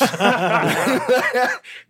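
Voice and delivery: loud real burst of laughter; big laugh. Real, not acted. Telling friends the story: loud real laughter. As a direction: crack up at the punchline.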